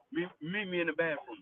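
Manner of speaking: very soft, low murmur; imitated whisper. Retelling the bathroom challenge to friends, hushed. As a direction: quote him under your breath.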